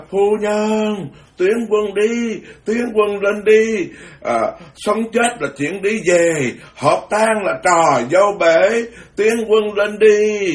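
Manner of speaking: high, slow commanding call to advance; Lady Trưng exhorting the troops, slow and high; rallying battle cry. Retold or imitated. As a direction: urge the troops forward like a heroine. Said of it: "bà nói".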